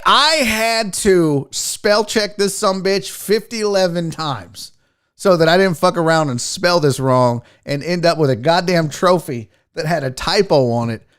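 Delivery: usual speaking voice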